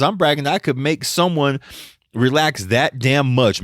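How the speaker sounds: ordinary delivery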